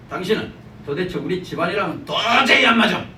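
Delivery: acted outburst, swelling to a shout; 격해진 남편. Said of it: "감정이 막 격해지는 겁니다". (격해져서 끝으로 갈수록 버럭)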